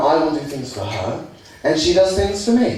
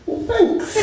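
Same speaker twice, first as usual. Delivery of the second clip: flattered. Retold or imitated. Flattered reply.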